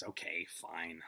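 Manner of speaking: low, soft, slow give-in; quiet, drawn-out surrender; sighing resignation. Acted. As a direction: sigh it out, reluctantly conceding. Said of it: "he kinda sighs and he says".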